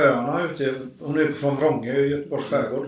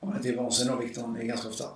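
usual speaking voice

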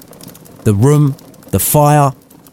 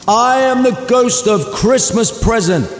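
much higher, ringing jolly giant voice; jolly spirit; 13 semitones higher than usual. Acted. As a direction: announce yourself like a merry giant ghost. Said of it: "and he was jolly".

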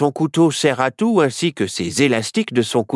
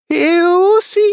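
very high, loud alarm; worried cry; 5 dB louder than usual. A narrator's reading. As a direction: shriek the warning in fright. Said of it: "s'inquiéta Myrtille".

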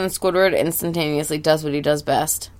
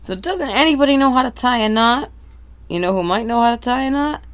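higher, desperate question; higher despairing plea; 7 semitones higher, faster than usual. Acted. Sponge desperately asking anyone for knot help. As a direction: cry it out to the empty sea.

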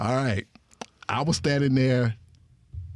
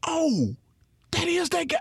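realization. Retold or imitated. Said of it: "I was like".

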